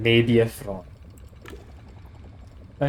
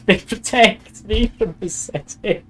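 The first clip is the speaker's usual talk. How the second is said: laughing; 7 semitones higher, 3 dB louder, slower than usual. Real, not acted. Laughs at his own misreading.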